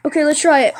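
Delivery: normal talking voice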